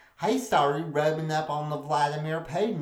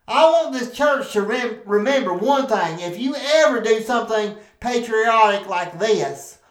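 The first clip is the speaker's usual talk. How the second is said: louder, higher pulpit address; preacher's warning; 5 semitones higher, 6 dB louder than usual. Acted. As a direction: call out to the congregation.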